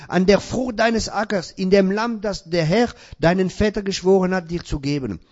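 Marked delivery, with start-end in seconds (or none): none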